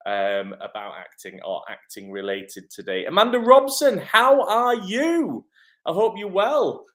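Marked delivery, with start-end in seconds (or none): 3.1-6.9 high, loud, excited hello; effusive greeting. Real, not acted. Host welcomes a viewer to the live, excited. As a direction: greet them in a big, high, stretched-out voice.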